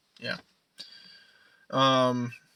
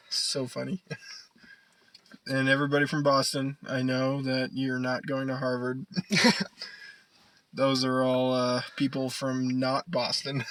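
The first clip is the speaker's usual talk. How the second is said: usual speaking voice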